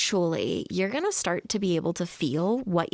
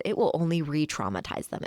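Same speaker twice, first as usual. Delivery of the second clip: ordinary talk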